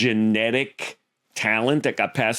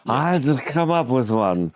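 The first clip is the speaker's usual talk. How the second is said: put-on tipsy movie-character voice; Dudley Moore as Arthur. Comic recalling a favorite impression, in Arthur's character voice. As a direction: slip into the film character's voice. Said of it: "you're doing Dudley Moore from Arthur".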